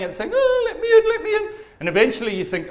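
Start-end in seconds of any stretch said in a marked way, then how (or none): 0.3-1.5 very high, squeaky pleading whine; whining dog. Acted. Dog scratching at the door to get in, whining. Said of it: "it's whining".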